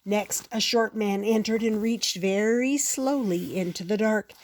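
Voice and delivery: ordinary delivery, nothing marked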